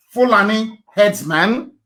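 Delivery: slow, deliberate Fulani herdsman; spoken very slowly. Shown as a demonstration. Urging listeners to Google it, said very slowly. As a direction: spell out Fulani herdsman slowly so they can type it. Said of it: "I will speak very slowly".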